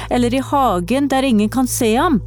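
put-on a boy catching the stranger out, sternly; stern shout. A narrator's reading. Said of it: "ropte Leo strengt".

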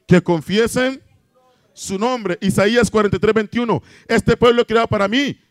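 ringing preacher's declamation; forceful scripture preaching. Acted. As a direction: call the verses out to the congregation. Proclaims scripture to congregation.

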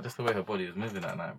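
normal talking voice

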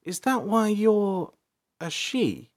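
high, hesitant, drawn-out question; sheepish. A narrator's reading. Princess asks the half-elf about gender, sheepishly. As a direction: ask haltingly and sheepishly. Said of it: "April asked sheepishly".

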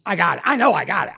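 loud, high, doom-struck insistence; panicked certainty. Retold or imitated. Patient convincing himself it is cancer, panicked.

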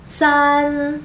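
"san" drawn out on a level pitch; first tone. Shown as a demonstration. Teacher shows hosts the number three, first tone. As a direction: hold "san" long and steady in first tone. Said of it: "Primer tono".

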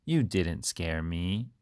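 usual speaking voice

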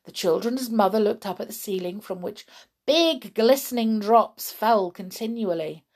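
ordinary delivery, nothing marked

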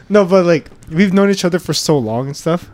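usual speaking voice

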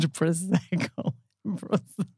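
scoffing through a laugh; laughing disbelief. Retold or imitated. Teasing a friend over ordering Prosecco, laughing. Mocking friend's drink choice.

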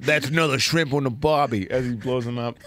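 hammed-up Aussie accent; badly faked Australian twang; terrible Australian accent. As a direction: do a bad Australian, played for laughs. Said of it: "My terrible Australian accent".

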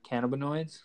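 ordinary talk

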